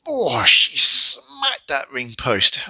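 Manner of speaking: slow, pained groaning wince; slow pained wince at the ring post crash; wincing. Real, not acted. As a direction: drag it out like it hurt you. Wincing at Rhea hitting post.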